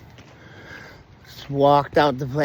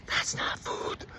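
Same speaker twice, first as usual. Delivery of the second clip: breathy unvoiced whisper, half to himself; whispered aside; 13 dB quieter, slower than usual. Muttering to himself about a patient's muffin, whispered. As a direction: hush it like a private dig at the snack.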